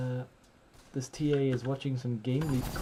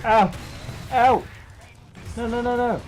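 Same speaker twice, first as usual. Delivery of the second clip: loud yelping protests; pained yelps; 10 semitones higher, 9 dB louder than usual. Real, not acted. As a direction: yelp as if hurt. Getting hit in game.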